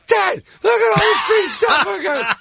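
very high squeal of an excited son; mock excited squeal. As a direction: squeal to Dad, hammed-up. Finn's son boasting, acted.

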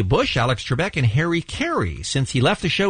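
ordinary talk, nothing marked

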